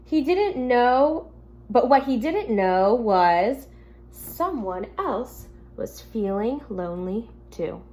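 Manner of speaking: gentle storybook reading to the class; reading to children. Acted. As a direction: read it like a teacher at story time.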